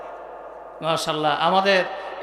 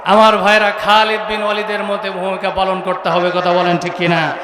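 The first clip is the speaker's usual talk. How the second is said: normal talking voice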